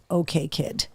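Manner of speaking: low, quiet imitation of a fed-up dog; lightly acted, hushed telling-off; fed-up older dog. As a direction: voice the dog's soft, low warning. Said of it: "she turns on her and she's like".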